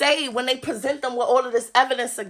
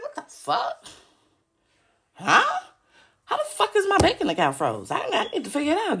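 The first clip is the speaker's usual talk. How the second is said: higher, confused half-laughing disbelief; mock bewildered; 6 semitones higher than usual. Imitating someone whose banking account is frozen, bewildered. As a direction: play baffled, a little giddy.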